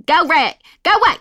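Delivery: loud, high Texan yell at the ghost; mother shouting. Retold or imitated.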